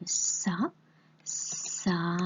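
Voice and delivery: "sa" by itself, s and a held; isolated syllable. Shown as a demonstration. Teacher correcting the spelling with a pupil, isolated syllable. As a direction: sound out "sa" slowly. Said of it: "on entend la syllabe".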